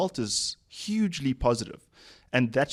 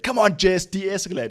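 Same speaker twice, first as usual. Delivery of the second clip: higher, punched-out imitation of urging; urgent mock prodding; 8 semitones higher, 4 dB louder than usual. Coach pushing employee to de-escalate, urgent imitation. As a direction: push them hard to step in. Acting coach pushing her mid role-play.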